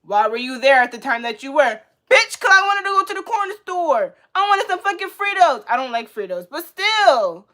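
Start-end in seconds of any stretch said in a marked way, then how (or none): 2.1-5.6 high, loud, exasperated clapback; indignant retort. Acted. Drive-by victim answering a blamer, indignant. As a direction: fire back loudly, pitched way up.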